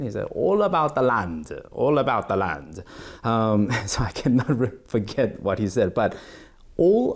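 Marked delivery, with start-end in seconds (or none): 0.3-1.5 put-on lilting, lightly Italian-accented quote; slight Italian accent. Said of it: "he had a slight Italian accent". 1.7-2.8 put-on lightly Italian-accented repeated catchphrase; slight Italian accent. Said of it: "he had a slight Italian accent".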